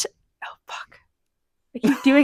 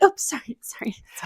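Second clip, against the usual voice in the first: high sorry fading to a whispered sorry, sorry; embarrassed apology; 4 semitones higher, 10 dB quieter than usual. Retold or imitated. Ripping paper in silence, apologizing sheepishly. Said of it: "and you're like".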